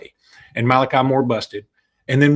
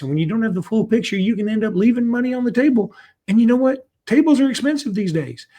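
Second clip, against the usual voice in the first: quip; 10 semitones higher than usual. Acted. Joke aside inside ad read.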